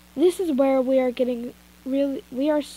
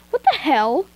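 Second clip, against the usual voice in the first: louder, shocked exclamation; startled; 2 semitones higher, 6 dB louder than usual. Acted. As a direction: jolt at the surprise. Character startled by hug.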